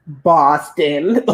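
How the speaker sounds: "Boston" drawn out slowly; puzzled repeated answer. Retold or imitated. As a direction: stretch "Boston" as if the answer were obvious. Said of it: "I'm not trying to give her attitude".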